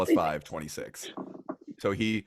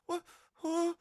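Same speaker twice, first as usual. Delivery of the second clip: put-on startled child. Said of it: "He, like, looks at the flower like".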